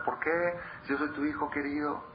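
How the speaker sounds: put-on understated child's tearful protest; crying child. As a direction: plead as the rejected son, lightly voiced. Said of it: "el otro se ponía a llorar".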